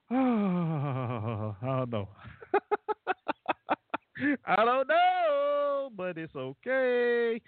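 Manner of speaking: slow, sighing through laughter; laughing, drawn out. Real, not acted. Host amused at himself, slow laughing drawl. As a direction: let the words stretch out as you chuckle.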